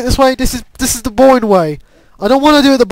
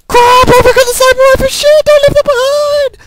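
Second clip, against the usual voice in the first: much higher, panicky cries; panicked outburst; 12 semitones higher than usual. Real, not acted. Gamer realising the sniper rifle is forgotten, panicked. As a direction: cry out in high-pitched alarm. Sudden panic during the game.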